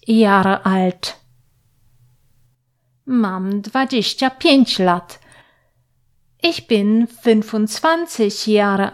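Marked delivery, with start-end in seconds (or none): none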